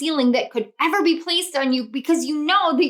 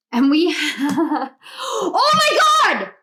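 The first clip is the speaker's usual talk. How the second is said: sudden high, loud shriek of excitement; real excited scream; excited yell; 3 semitones higher, 3 dB louder, slower than usual. Real, not acted. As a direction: burst out yelling with joy. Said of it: "I'm yelling really loud".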